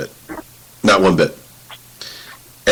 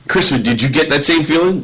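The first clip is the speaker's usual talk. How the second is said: ordinary delivery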